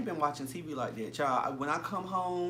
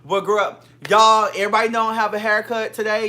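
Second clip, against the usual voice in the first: much louder, to the viewers; loud playful denial; loud protest; 4 semitones higher, 12 dB louder than usual. Real, not acted. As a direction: call out to the audience, loud. Defending on live to viewers.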